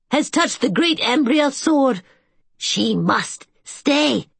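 high keeper's voice, slowing hard on the end; fretful old caretaker. A narrator's reading. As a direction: insist she stays, dragging the last words. Agamon insists she stay.

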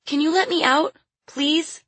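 put-on high, pleading child's voice; pleading little girl. A narrator's reading. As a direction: beg as a small caged girl. Caged little girl begs to get out.

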